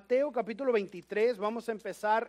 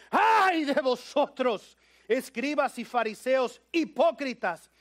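shouting Jesus; 5 dB louder than usual. Acted. Preacher voicing Jesus rebuking the Pharisees loudly. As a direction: denounce them with force. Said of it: "les dice".

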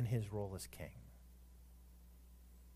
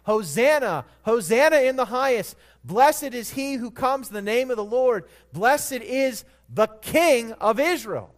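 loud, high acclaim for a king; crowd's cry; 10 semitones higher, 14 dB louder than usual. Retold or imitated. Preacher voicing the Palm Sunday crowds, loud and high. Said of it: "what did the crowds cry?".

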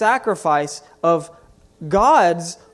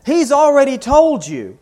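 emphatic; 6 semitones higher, 6 dB louder than usual. Real, not acted. Preacher echoing the shouted verse.

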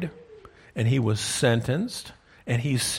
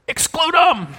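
high, loud called answer; congregation shout; 7 dB louder than usual. Pretend. Preacher voicing the congregation answering Paul's question, a loud high shout.

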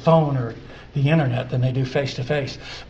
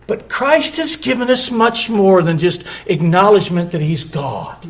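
ordinary talk, nothing marked

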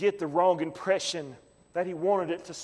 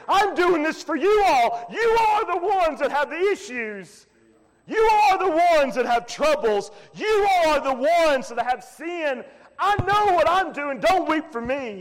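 loud, raised voicing of Jesus; preaching at full force; 9 semitones higher, 5 dB louder than usual. Preacher voicing Jesus to the weeping women, loud. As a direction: drive each line home.

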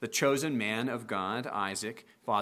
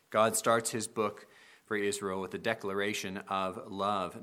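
ordinary delivery

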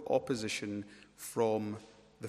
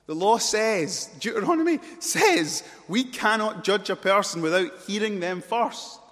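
loud, high, insistent appeal to the law; impassioned objection; 9 semitones higher, 12 dB louder than usual. Acted. Nicodemus confronts fellow leaders over the law, shouting. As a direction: hammer out the rule at full voice.

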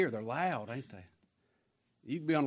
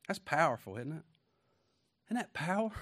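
ordinary talk, nothing marked

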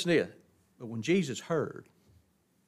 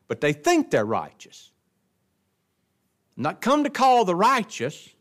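higher, louder preacher's build; full-force preaching; 7 semitones higher, 4 dB louder than usual. Acted. Preacher to congregation about Pharisees, rising fervent preaching.

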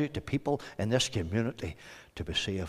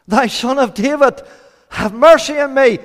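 high, loud shouted plea; acted beggar crying out; top of the voice; 11 semitones higher, 12 dB louder than usual. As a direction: shout desperately for mercy. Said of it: "At the top of his voice".

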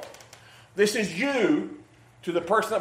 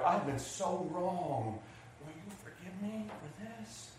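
slow, hushed, humble apology; contrite apology; 18 dB quieter, slower than usual. Acted. Pastor Phil humbly asking Tim to forgive, hushed and slow. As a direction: plead softly for forgiveness.

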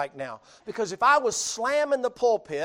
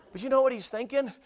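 ordinary talk

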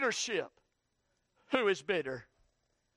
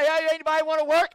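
boss calling out; 6 semitones higher, 10 dB louder, faster than usual. Retold or imitated. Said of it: "They say".

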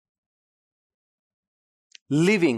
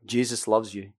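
a much lower, softer throwaway line; mock slogan; 10 semitones lower, 7 dB quieter than usual. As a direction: toss it off low and soft.

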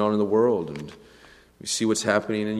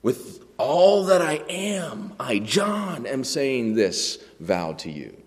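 solemn vow; 3 semitones higher, slower than usual. Acted. Groom pledging himself to bride, solemn and emphatic. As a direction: pledge yourself weightily, word by word. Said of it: "because of the solemnity of what's happening".

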